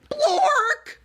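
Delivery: high, loud nonsense yelp; a squeaky yelled "Blork!"; silly shout. Acted. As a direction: blurt out "Blork!" like a wild shriek. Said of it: "Wait, what?".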